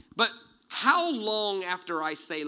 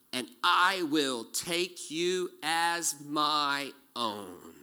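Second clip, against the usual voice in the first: solemn scripture reading; 2 semitones higher, 4 dB louder, slower than usual. Acted. Preacher reading God's words to slaves, solemn. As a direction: read it slow and weighty. Exodus chapter six.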